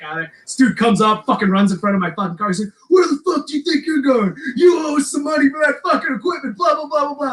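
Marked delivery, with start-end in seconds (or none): 2.9-7.3 imitating angry guy. Angry stranger stopping the fleeing singer, mock shout. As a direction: ham up the furious guy blocking the car.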